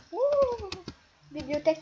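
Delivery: loud, high outraged yelp; loud wounded cry of betrayal. Real, not acted. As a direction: yelp at the traitor. Betrayed by the bookcase.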